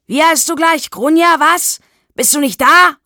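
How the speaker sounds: very loud, high-pitched yell; shouting. A narrator's reading. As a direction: holler into an empty flat for someone. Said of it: "schrie er in der halbdunklen Diele". (lautes, hohes Schreien)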